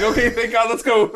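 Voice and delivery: high, loud, relieved outburst; mock relief. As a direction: urge the car off in acted relief. Acted relief as car starts.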